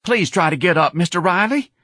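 high, loud nervous plea; anxious pleading. Acted. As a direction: beg in a worried tenor. Frightened manager pleads with fallen clerk.

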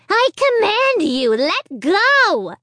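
high, loud, slow evil puppet command; commanding dummy. Acted. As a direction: order it like a tyrant dummy. Slappy orders Amy.

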